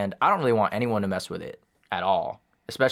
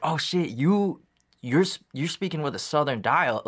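high-pitched, halting mock astonishment; amazed, stumbling over the words; retold amazement; 6 semitones higher than usual. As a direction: stammer it out like a startling discovery. Retells own amazed reaction to kid.

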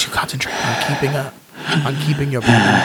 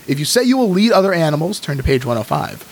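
usual speaking voice

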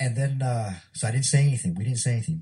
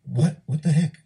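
retold disbelief; 5 semitones higher than usual. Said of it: "I was like".

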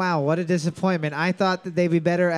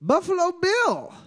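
high excited reveal; 10 semitones higher than usual. Acted.

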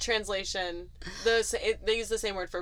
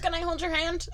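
put-on little kid voice; 9 semitones higher than usual. Quoting the toddler's request.

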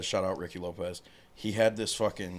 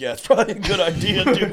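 higher, louder and fast teasing reply; mocking high agreement; 9 semitones higher, 9 dB louder than usual. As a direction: egg him on, laughing high.

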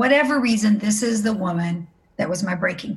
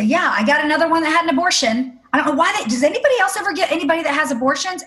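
higher-pitched mock exasperation; exasperated complaint; 6 semitones higher, faster than usual. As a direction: retell it as a pitched-up, exasperated gripe. Retells own exasperated complaint to friend.

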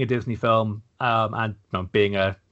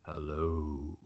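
whisper; 8 semitones lower, 12 dB quieter than usual. Acted. Joins the spooky intro.

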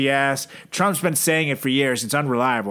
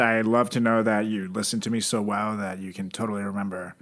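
sarcastic; 6 semitones lower, 5 dB quieter than usual. Acted. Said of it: "great listening skills on your end".

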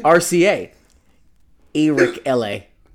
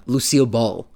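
ordinary talk, nothing marked

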